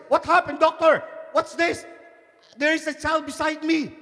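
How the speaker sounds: high-pitched alarmed questioning; surprised. Acted. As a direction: demand answers in high, startled disbelief. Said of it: "he was surprised".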